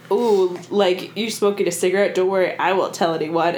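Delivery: ordinary talk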